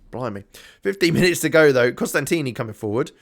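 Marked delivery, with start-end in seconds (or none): none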